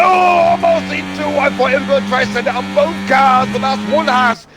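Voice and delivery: high excited race call; motorsport commentator. Acted. Live call of overtake.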